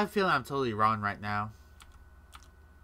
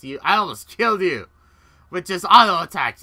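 high, loud taunting yell at the enemy; gloating shout; 7 semitones higher, 12 dB louder than usual. Retold or imitated. Gamer taunting the enemy he nearly killed, loud high gloat. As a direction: crow it loudly, almost shouting. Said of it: "I'm just like".

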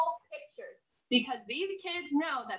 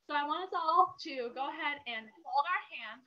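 plain reading voice

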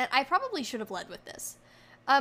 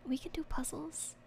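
whispering; 11 dB quieter than usual. Real, not acted. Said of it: "I have to whisper for the next hour".

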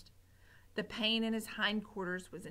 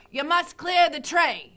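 louder, higher bossy shout; cook shouting; 6 semitones higher, 11 dB louder than usual. Acted. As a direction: yell the order across the kitchen. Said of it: "shouted Cook".

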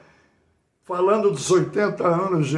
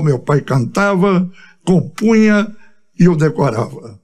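measured cordel-style recital, phrase by phrase; rhymed monologue recitation; 8 dB louder than usual. Acted. Singer recites his eighty years of life in rhyme, declaimed. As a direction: declaim the verses firmly, pausing after each line.

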